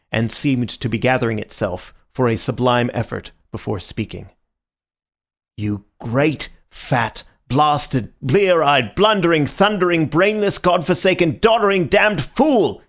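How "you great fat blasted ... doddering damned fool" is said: building from plain to a loud high rant; one-breath insult torrent, building; breathless tirade. A narrator's reading. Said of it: "without taking breath".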